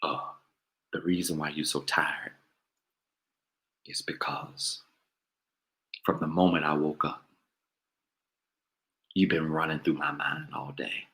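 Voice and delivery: low, hushed, breathy crooner purr. Retold or imitated. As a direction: seduce her with a low, husky croon.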